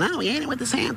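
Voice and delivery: high, prissy complaining viewer; mock viewer complaint. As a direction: whine it like a fussy viewer. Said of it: "I don't want anybody writing in".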